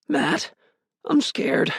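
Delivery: put-on high, small scared child voice; quivering, scared. A narrator's reading. Little brother on the phone to his brother, scared and quivering. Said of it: "His voice quivered".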